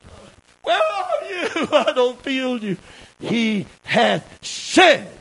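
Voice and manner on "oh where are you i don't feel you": lightly mock a doubter's morning complaint; a hint of whining doubt, then a laugh; mock worried believer. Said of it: "get up in the morning and say".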